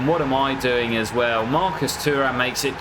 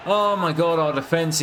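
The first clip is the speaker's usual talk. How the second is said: exasperated exclamation; 5 semitones higher than usual. Real, not acted. Concedes a goal.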